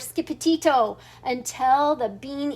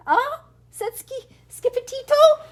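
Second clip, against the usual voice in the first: surprised; 11 semitones higher, 3 dB louder than usual. Acted. Surprised character question.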